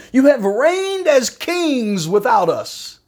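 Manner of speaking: slow, high-pitched mocking praise; sarcastic. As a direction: draw it out in exaggerated sarcasm. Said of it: "Paul's getting sarcastic here".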